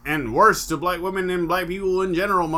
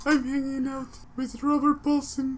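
higher, softer spooky voice; hammed-up ghost voice; 9 semitones higher, 3 dB quieter than usual. Ghost tells friends who he hangs out with, high and spooky. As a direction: play the ghost bragging about the afterlife.

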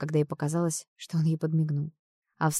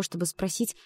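usual speaking voice

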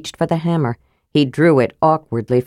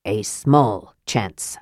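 much slower, lower and softer, dwelling on small; slow, downplaying; 8 semitones lower, 5 dB quieter, much slower than usual. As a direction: stretch out small chance to make it seem tiny. Repeated slowly to reassure.

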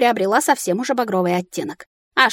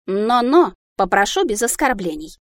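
usual speaking voice

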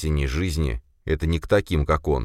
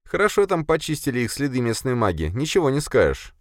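much higher female-character voice; Sofia, resigned; 9 semitones higher than usual. Acted. Sofia reports no evidence to boss, resigned.

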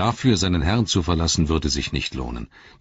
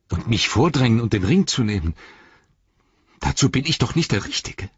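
high-pitched, distressed lament; anguished self-doubt, voice raised in pitch; Sam, despairing; 8 semitones higher, 4 dB louder than usual. A narrator's reading. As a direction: plead for someone else to decide.

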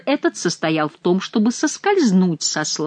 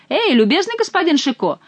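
calling out; 9 semitones higher than usual. A narrator's reading. Monk calls out to Chicot.

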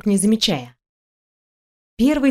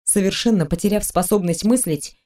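usual speaking voice

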